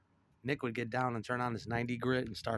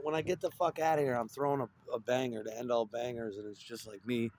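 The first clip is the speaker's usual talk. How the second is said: ordinary talk, nothing marked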